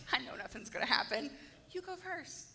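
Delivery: understated cocky retelling; a little cocky. Retold or imitated. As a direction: toss it off softly, quietly sure of winning. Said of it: "being a little cocky there".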